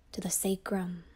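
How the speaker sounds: ordinary delivery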